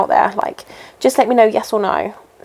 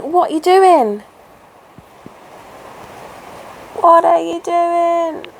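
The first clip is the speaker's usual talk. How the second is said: slow, higher baffled scolding; mock-exasperated at horses in the heat; 8 semitones higher, slower than usual. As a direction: plead with the horses in disbelief. Voicing herself scolding the horses.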